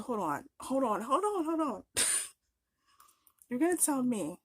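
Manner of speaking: worked-up hold on, pitch climbing; getting worked up. Real, not acted. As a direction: stop them mid-thought, rising in outrage. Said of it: "now I'm getting worked up".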